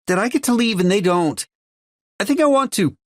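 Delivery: put-on a boy blurting out what he wants, high and loud; small boy. A narrator's reading. Everett voiced as a boy.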